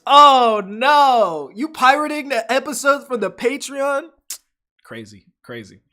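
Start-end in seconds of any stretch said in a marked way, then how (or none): 0.1-4.1 much higher and louder mock outrage; shocked outcry. Real, not acted. Host reacting to a listener pirating episodes, shocked. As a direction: gasp it out in disbelief.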